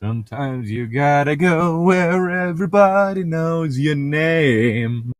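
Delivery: ordinary talk, nothing marked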